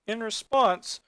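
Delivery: ordinary delivery, nothing marked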